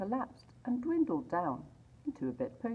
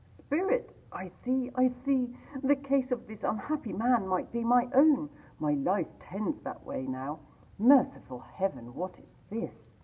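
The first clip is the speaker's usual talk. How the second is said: put-on older man, higher and pressing, rising to horror; Scrooge pleading; 6 semitones higher, 4 dB louder than usual. A narrator's reading. Scrooge to the Ghost after overhearing the thieves, horrified. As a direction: plead with the spirit in dawning dread.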